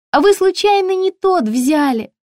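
very high, chirpy shop assistant; high-pitched, ringing sales voice; ringing saleswoman voice. A narrator's reading. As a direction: pipe up brightly through the door. Ringing-voiced saleswoman questions size.

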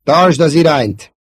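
loud, high, urgent call; shouted instruction. Acted. Wingman telling Luke to hold course, shouting. Wingman calls during rescue.

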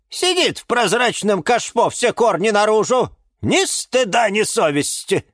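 high-pitched indignant tirade; shrill-pitched, outraged nagging; scolding. A narrator's reading. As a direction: scold her for having no shame. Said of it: "прикрикнула на неё Розалия Львовна".

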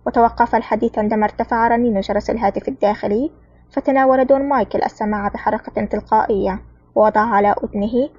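ordinary delivery

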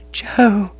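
high startled cry of a name; gasping cry. A narrator's reading. Aunt recognising her long-lost sweetheart, startled cry. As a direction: gasp the name out in shock. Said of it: "cried Aunt Julia".

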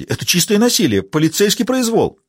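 high, alarmed protest; a flustered blonde crying foul at the cop; flustered outcry. A narrator's reading. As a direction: squeal it out in a flustered panic. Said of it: "всполошилась Сэнди".

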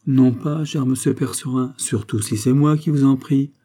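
usual speaking voice

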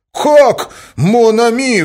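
high, very loud outraged shout; enraged cry. A narrator's reading. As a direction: explode in disbelief and fury. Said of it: "вскричал он в бешенстве". (в бешенстве, криком)

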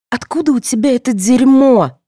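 high, loud, appalled question; groaning dismay. A narrator's reading. Daughter appalled by mother's key, groaning. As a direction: demand an answer in dismay. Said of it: "простонала Соня".

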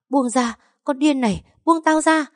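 a beaten rival shrieking to be released; shrieking to be let go. Acted. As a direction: scream at her to let go. Rival screams while being beaten.

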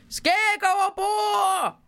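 high, long-drawn captain's bellow; captain's roar. A narrator's reading. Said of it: "brølede han".

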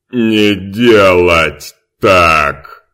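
loud, very slow broken-speech order; slow booming command. Acted. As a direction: bark the order word by word. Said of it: "сказал Димка".

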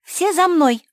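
high, loud call to follow; rallying shout. A narrator's reading. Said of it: "закричала Куки и ринулась вперёд". (призывный крик)

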